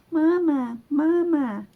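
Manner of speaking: high little gosling calling after him; baby gosling voice. Acted. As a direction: chirp it like a tiny chick. Goslings follow Bruce home.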